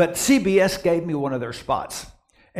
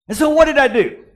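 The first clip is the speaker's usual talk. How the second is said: high, loud rhetorical question; rising excitement; 10 semitones higher, 8 dB louder, faster than usual. Acted. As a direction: build the suspense up big. Preacher builds to punchline.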